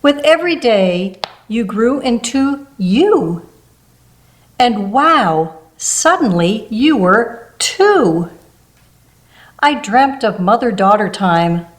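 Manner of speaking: usual speaking voice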